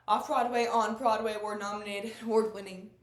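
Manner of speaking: very loud, proud stage proclamation; exultant, projected listing; triumphant boast. Acted. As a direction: trumpet each credit at full voice. Actress boasting of her career.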